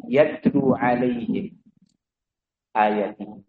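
quiet, slow intoned Arabic phrase; quiet verse recitation; soft Quranic recitation. Acted. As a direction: recite it gently, almost to yourself. Recites verse again, softly.

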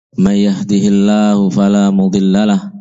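ordinary talk, nothing marked

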